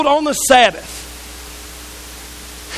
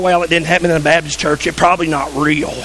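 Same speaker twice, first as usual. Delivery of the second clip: much lower, dour mimicking voice; dismissive churchgoer; 9 semitones lower than usual. Churchgoer doubting another church's healing, low dismissive mimicry. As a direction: drop low to mock a sceptical naysayer.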